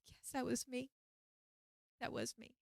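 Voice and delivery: tiny, high, hushed voice; sheepish admission. Retold or imitated. Admitting to the helper it was her, sheepish near-whisper. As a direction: own up very softly.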